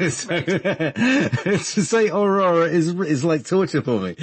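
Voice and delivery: laughing through the words; giggling as he speaks. Real, not acted. Laughs at own trouble with R.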